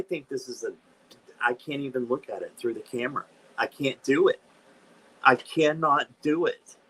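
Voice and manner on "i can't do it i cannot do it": real upset. Real, not acted. Can't look at card.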